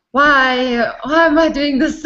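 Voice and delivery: normal talking voice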